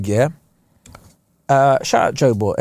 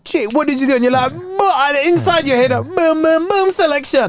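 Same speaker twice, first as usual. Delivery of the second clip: excited DJ; 12 semitones higher than usual. Retold or imitated. DJ mix story acted out.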